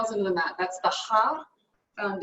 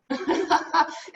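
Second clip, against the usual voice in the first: bright laugh; 6 semitones higher than usual. Real, not acted. Laughing at own joke.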